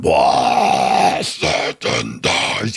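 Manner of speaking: slow, dragged-out mock metal wail; mock metal singer. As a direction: ham up a metal frontman's howl. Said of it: "some guy going".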